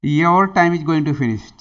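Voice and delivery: slow, loud teacher warning; drawn-out loud reminder that time is ending. Real, not acted. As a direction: call it out slowly to hurry the class. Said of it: "teacher warns time is up".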